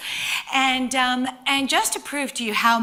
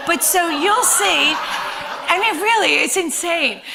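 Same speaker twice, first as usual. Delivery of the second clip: words pushed out between laughs; laughing; 7 semitones higher, 7 dB louder than usual. Real, not acted. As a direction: still laughing, louder and higher. Still laughing at mishap.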